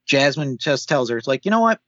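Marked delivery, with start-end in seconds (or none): 1.4-1.8 high, exasperated retold retort; fed up. Jasmine telling off her sister, fed up. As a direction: open a mock fed-up retort, pitched high.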